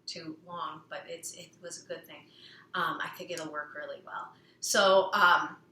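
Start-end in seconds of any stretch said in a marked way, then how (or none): 0.0-4.3 soft unvoiced whisper; whispered aside. Real, not acted. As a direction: drop to a secret whisper.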